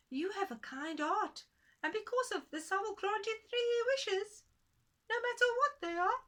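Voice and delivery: a magical little man making his offer; tiny man granting wishes. Acted. Tiny man granting the woman three wishes, very high and grand.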